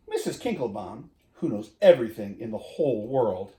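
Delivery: usual speaking voice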